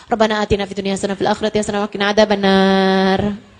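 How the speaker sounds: perfunctory prayer gabbled in one breath; unserious rattled-off prayer. Retold or imitated. Preacher mimics a half-hearted worshipper's prayer, rattled off carelessly. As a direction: rush through it low on a monotone, careless. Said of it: "Ini nih nggak serius doanya".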